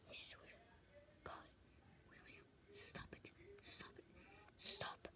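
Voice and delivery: scared hushed pleading; whispered frightened plea. Real, not acted. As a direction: beg him quietly, terrified. Pleading with monster in fear.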